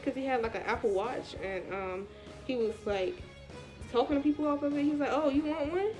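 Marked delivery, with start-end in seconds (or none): none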